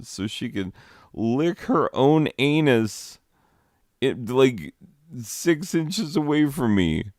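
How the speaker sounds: rising sarcastic indignation at the cat; indignant. Real, not acted. Complaining about the cat.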